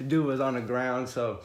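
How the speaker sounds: normal talking voice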